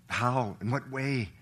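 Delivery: ordinary delivery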